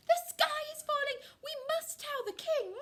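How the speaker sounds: high, loud bird panic; shrill-pitched group alarm; squawking and clucking. A narrator's reading. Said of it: "squawking and clucking".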